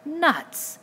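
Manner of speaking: high, loud exclamation; sudden indecorous cry, falling steeply; shocked outburst. A narrator's reading. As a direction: blurt it out in disgust. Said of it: "shocked out of decorum".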